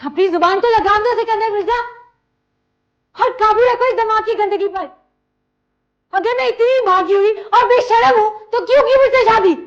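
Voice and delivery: loud, very high-pitched angry tirade; furious shouting. Acted. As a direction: shout back and demand an answer. Wife answers back in furious quarrel.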